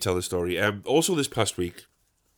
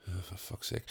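very low, hushed mock grumble; exasperated aside; 4 semitones lower, 20 dB quieter than usual. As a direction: grumble privately, well under your breath. Private groan at the chatty gardener.

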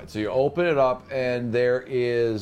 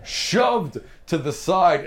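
loud; 6 semitones higher, 6 dB louder, much slower than usual. Acted. Crocodile shoves you.

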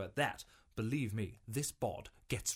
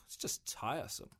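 weary sigh; 8 dB quieter than usual. Acted. Rob sighs about admirers.

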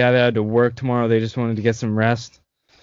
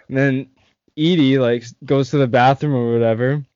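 ordinary talk, nothing marked

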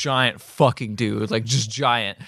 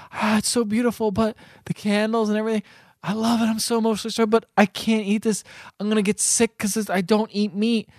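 higher, gushing mock girlfriend; gushing girlfriend impression; 8 semitones higher than usual. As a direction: pitch it up and gush like a dramatic girlfriend. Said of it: "she just looks at it and goes".

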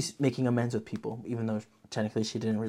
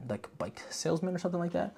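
ordinary delivery

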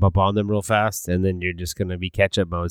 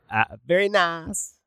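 put-on high, slow Borat accent on very nice; 9 semitones higher, 3 dB quieter, slower than usual. Mocking the Borat catchphrase for his cohost, Borat accent. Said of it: "that friggin' annoying-ass Borat accent".

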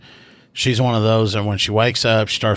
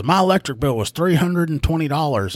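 higher-pitched retold complaint; upset caller; 7 semitones higher than usual. Upset caller telling the rookie about her bill, mildly indignant. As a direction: lightly imitate an aggrieved caller. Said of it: "she's upset because her electric bill".

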